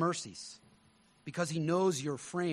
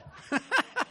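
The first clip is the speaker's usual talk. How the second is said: laughing. Real, not acted. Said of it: "laughing at own joke".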